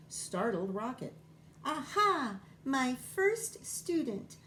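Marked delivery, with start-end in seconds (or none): 1.7-4.4 high, loud, slow sing-song bird voice; an eager little bird greeting her first pupil; sing-song bird teacher. A narrator's reading. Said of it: "she sang".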